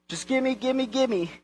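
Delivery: mock a kid's greedy begging; mimicking demanding child. Preacher showing that same attitude, childish begging. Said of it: "It's that same attitude".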